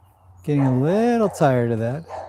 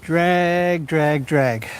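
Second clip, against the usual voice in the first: slow drawn command; 3 semitones higher, much slower than usual. Real, not acted. Cueing horse to drag rope.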